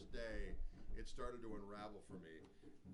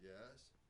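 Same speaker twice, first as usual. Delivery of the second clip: weary sigh; 2 semitones lower, 7 dB quieter than usual. Retold or imitated. Guitarist humoring a friend's pickup pitch, wearily sighing. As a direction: sigh out a mock-reluctant reply.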